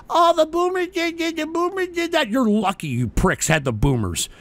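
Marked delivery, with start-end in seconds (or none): 0.1-2.3 high, mocking whine of the critics; mocking higher whiny impression; whiny mock quote. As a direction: sneer it in a mock crybaby voice. Mocking what the youth say.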